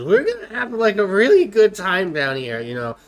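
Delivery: loud, high tipsy call-out; tipsy barmaid. Acted. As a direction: call out to the room, half drunk. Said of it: "calls out to the other people and says".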